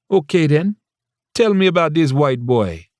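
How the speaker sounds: put-on high eager Jamaican accent; a lightly voiced curious Jamaican mother. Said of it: "her lyrical Jamaican accent".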